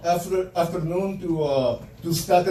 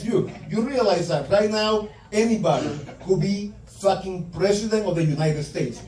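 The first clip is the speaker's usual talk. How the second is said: ordinary talk, nothing marked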